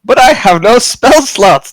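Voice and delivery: high, loud drawn-out cry of mock despair; mock wail. Player protests to DM about having no spell slots, mock wail. Asked to roll initiative.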